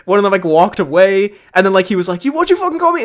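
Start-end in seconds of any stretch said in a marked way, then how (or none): 2.3-3.0 high, affronted Irish lad; Irish accent. Retold or imitated. Lad turning back on his rival, affronted Irish accent. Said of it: "I love your accent".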